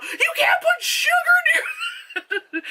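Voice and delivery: very high, slow shriek through laughter; screamed warning. Retold or imitated. Vlogger yelling at the screen about sugar, shrieking. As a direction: scream it, cracking up. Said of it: "I just blurt out".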